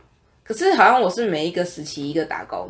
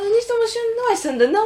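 much higher, puzzled imitation of an old man; high mock old man; 13 semitones higher than usual. Old man doubting the girl's accent, high mock voice. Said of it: "他说".

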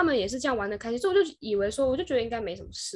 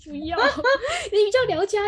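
higher, louder playful no with a laugh; teasing coworker, laughing and louder; laughing refusal; 10 semitones higher, 7 dB louder than usual. Retold or imitated. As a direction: laugh it off and push the job back. Coworkers pushing the task around.

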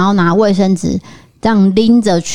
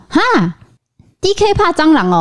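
high teasing mother voice; teasing mom; 9 semitones higher than usual. Retold or imitated. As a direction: tease him like mom does. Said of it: "我妈妈常常也说".

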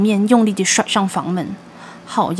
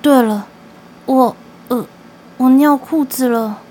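embarrassed confession; 3 semitones higher, 3 dB louder than usual. Acted. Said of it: "我很快地承认".

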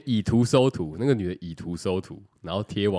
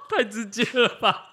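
high laughing reaction; 7 dB louder than usual. Real, not acted. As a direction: laugh it out, amused and shocked. Reacting to blunt ad.

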